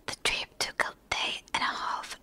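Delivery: ordinary delivery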